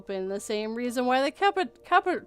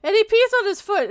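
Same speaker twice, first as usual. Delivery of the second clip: put-on higher, louder tattling child; tattling kid; 9 semitones higher, 7 dB louder, faster than usual. Kids listing dad's flaws, louder child. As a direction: tell on dad like a kid. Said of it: "tattling kid".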